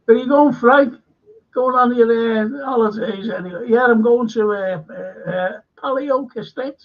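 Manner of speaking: high, loud put-on voice for Frank's chat; acted dialogue. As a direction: play both sides of the holiday chat.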